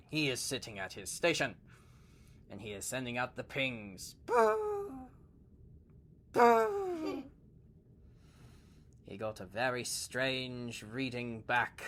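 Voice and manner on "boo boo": high, far-off "Boo!" like a sonar ping. Retold or imitated. Sonar operator sending pings.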